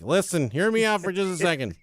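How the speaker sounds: hammed-up persuasive pitch; mock pitch to wife. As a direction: plead your case, projecting. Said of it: "Told my wife".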